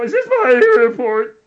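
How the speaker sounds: mock his cracked voice; imitating a cracking voice. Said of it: "Yeah, you go".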